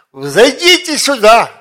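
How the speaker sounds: high, loud summoning call; God's command. Acted. God summons the faithful up to heaven, commanding. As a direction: call them up from on high, loudly. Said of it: "и сам Бог сказал".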